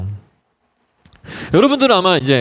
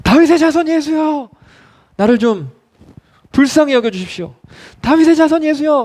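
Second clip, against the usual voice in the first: acted blind beggar's cry; determined crying out; 9 semitones higher than usual. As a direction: call out desperately for help, pitched high. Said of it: "작정하고 소리를 지릅니다".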